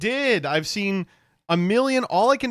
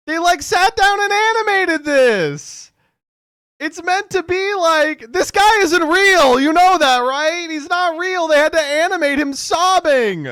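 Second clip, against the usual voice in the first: exasperated; 13 semitones higher, 8 dB louder than usual. Real, not acted. Exasperated rant about animating sadness.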